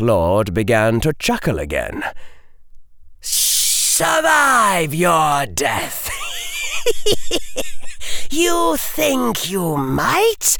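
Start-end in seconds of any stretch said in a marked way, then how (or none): 3.2-6.1 high, slow sneering villain shout; cold rasping evil emperor. Acted. Dark Lord mocking Harry. 6.2-8.2 high shrieking villain cackle; evil cackle. Acted. Dark Lord laughs at Harry.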